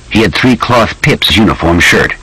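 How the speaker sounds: usual speaking voice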